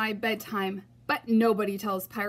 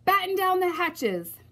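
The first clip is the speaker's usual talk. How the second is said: high, loud captain's order; shouting; 9 semitones higher, 4 dB louder than usual. Acted. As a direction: bark it over the wind. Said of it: "the Braidbeard shouted".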